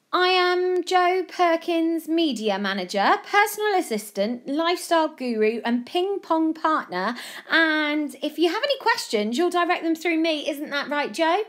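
high, loud, grand introduction; self-appointed media manager. Acted. Friend introducing himself to reporters, grandly, high and loud. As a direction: announce your list of titles importantly.

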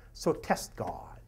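hushed, slow tempting whisper; whispered taunt. Acted. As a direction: breathe it like the devil's secret dare.

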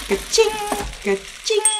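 high ringing ka-ching; high, chiming register sound; cash register imitation. A narrator's reading. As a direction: ring it up like a till. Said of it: "deed de kassa".

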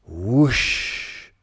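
loud blowing wind whoosh; whoosh sound. Pretend. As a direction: blow it out like a gust. Leaves blowing away.

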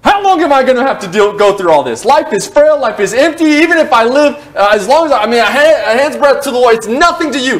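David finally bursting out in anguished frustration; frustrated outburst. Acted. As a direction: blow up at the Lord, pleading and exasperated. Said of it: "finally he blows up".

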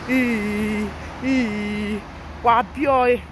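loud drawn-out fake wail; taunting loud imitation of crying; mock crying. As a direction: bawl long vowels in mockery. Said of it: "people are now crying and say".

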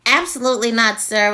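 normal talking voice

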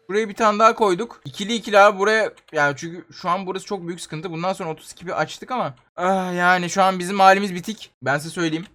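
normal talking voice

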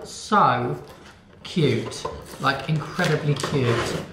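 ordinary talk

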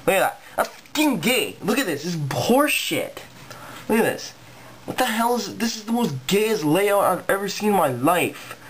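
outraged, incredulous ranting; indignant rant. Real, not acted. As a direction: pitch jumps up in disbelief. Ranting in disgust at new layout.

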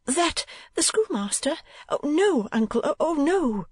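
put-on high young lady recoiling; shrinking alarm. A narrator's reading. Niece shrinking back from the schoolmaster, high dismayed refusal. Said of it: "shrinking back".

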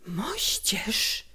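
hushed, disbelieving echo; whisper. A narrator's reading. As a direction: whisper it back as a question. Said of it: "powtórzyłem szeptem".

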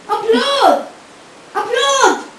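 mock-pompous demand; demanding applause. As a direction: demand the crowd clap. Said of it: "In fact, it's me".

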